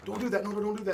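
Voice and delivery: high, singsong mock scolding. Trainer imitating owners gently scolding a chewing dog, high and singsong. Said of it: "I'm going".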